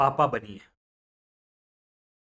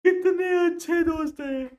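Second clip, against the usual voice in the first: high, louder crying cry on कितने; mock crying wail; 13 semitones higher, 4 dB louder than usual. Punjabi crying out about his friends, hammed-up wail.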